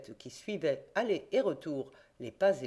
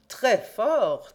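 louder, higher mocking call; shouted praise; 5 semitones higher, 8 dB louder than usual. A narrator's reading. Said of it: "cria de sa place Frédéric Larsan".

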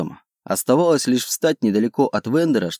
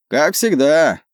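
high-pitched call back; shouted reply; 5 semitones higher, 3 dB louder, slower than usual. A narrator's reading. Captain replies to his friend's ship, calling back. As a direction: answer across to the other ship, voice raised. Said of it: "крикнул в ответ Вокал".

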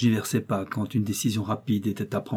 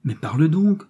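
raised, urgent demand; higher-pitched alarmed impatience; sudden worry; 6 semitones higher, 4 dB louder than usual. A narrator's reading. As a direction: press for an answer, suddenly anxious. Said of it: "saisie d'une subite inquiétude".

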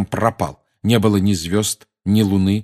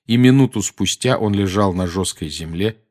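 usual speaking voice

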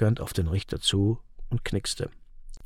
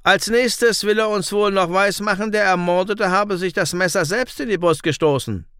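mocking, higher and louder judge; sneering judge; 7 semitones higher, 4 dB louder than usual. A narrator's reading. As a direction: jeer at the accused's excuse. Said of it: "höhnte der Richter".

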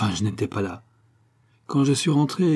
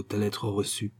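ordinary delivery

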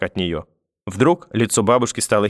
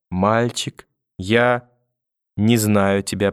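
slow, halting, long pauses between words; laboured, feigned delirium; much slower than usual. A narrator's reading. Grandmother disowning grandson from floor, faintly and haltingly. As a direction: drift through it as if losing consciousness. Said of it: "с трудом проговорила".

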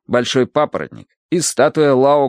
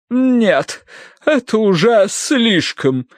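high, drawn-out protest; overwhelmed matron, high and slow; dramatic indignation; 11 semitones higher, much slower than usual. Acted. Aunt declaring it all too much, dramatic indignation.

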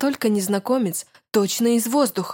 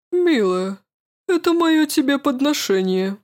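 courtly man's voice; 6 semitones higher, 4 dB louder than usual. Acted. Man offers the heart to her.

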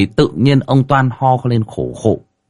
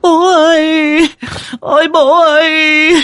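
high, loud, drawn-out mourning wail; wailing cry; 5 dB louder, slower than usual. A narrator's reading. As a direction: wail slowly for a dying parent. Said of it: "Đám con cháu xung quanh thì gào khóc".

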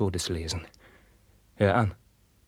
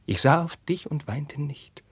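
higher, slower, proud; raised, slow; 6 semitones higher than usual. Acted. Carlos reminding Roderich he bore it, high and slow. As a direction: lift the voice and hold it steady. Climax of the memory.

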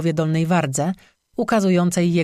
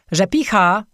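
moderately raised calling voice; 2 semitones higher, 4 dB louder than usual. A narrator's reading. Customer calls to the innkeeper in the kitchen, voice raised. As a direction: call her name across the room. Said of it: "zawołał w stronę kuchni".

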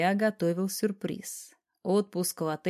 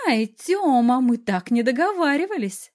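loud, higher indignant yelp; indignant protest; 6 semitones higher, 7 dB louder than usual. A narrator's reading. Wife protests husband's playful attack, indignant. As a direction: protest loudly, pitched up. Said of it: "возмутилась Сашка".

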